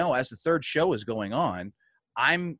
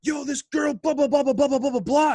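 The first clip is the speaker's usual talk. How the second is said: high, loud mock rant; imitating angry ranting; 14 semitones higher, 6 dB louder than usual. Wrestler imitating his worked-up friend ranting about a woman, angry mock rant. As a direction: run the blahs together in a high, worked-up squawk. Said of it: "Why are you so angry?".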